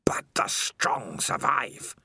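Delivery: slow, ghostly whisper from the dark; a creeping, hissing whisper of a lurking monster; eerie spider voice. Acted. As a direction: breathe it like a spider in his head. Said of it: "said the voice of Spider".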